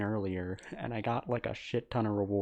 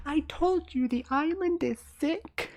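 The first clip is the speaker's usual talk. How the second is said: high-pitched mock frail girl, louder; 5 dB louder than usual. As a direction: parody the pale girl in a squeaky high voice. Mocking the frail girl character.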